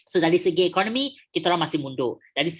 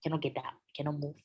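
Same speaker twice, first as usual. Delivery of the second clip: cannot get up said softly, trailing down; softer, like someone who cannot move; quiet; 3 semitones lower, 12 dB quieter than usual. Shown as a demonstration. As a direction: drop to a low, limp murmur. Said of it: "Kita tak boleh jalan".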